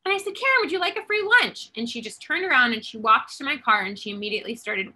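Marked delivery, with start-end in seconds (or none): 0.4-1.7 high, bright call with the name; high friendly call from the car; cheery offer. Retold or imitated. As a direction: sing out her name and offer lunch. Retelling her offer by name.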